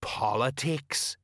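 slow, meaningful single word; drawn-out, all-explaining hint; knowing. Acted. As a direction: drop it like a great secret. Colon hints knowingly, tapping nose.

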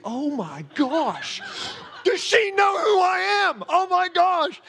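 slow breathless gasp rising to a high shout; overwhelmed with surprise, voice jumping way up; stunned amazement. Retold or imitated. Retelling his shock at the woman's prophecy, high excited shout. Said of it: "I'm like".

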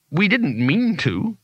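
ordinary delivery

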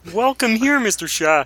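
very high puppet-frog welcome; Kermit. Retold or imitated. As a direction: greet a guest as an excited frog puppet. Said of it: "every time you fucking say Kermit".

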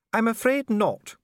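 high, slow genteel lady; a noble lady musing aloud; Lady Sybil. A narrator's reading.

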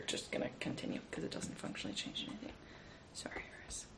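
quick whisper under the breath; whispered aside. Real, not acted.

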